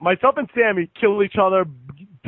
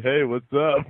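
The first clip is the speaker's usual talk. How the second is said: a lower, lazy cat voice; the chill cat greeting the crowd; voicing the cat; 6 semitones lower, slower than usual. Voicing the doped-up cat in his arms, lazy and low.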